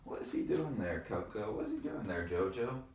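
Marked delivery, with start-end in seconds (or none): none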